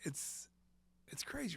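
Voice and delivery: ordinary talk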